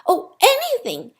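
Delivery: high, delighted outburst; eager cry. A narrator's reading. The little soul eagerly agreeing to anything, excited cry. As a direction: burst out with joy, pitch soaring. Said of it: "cried the little soul".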